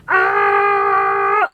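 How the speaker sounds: loud, long shouted "ah"; imitated own yell. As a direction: re-enact your yell at full volume. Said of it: "So he's just heard me go".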